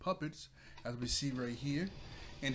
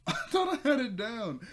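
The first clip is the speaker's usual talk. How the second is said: much higher, louder, laughing through it; 10 semitones higher, 7 dB louder than usual. Real, not acted. The reviewer laughing at having left the volume up, real laughter. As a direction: laugh at your own blunder.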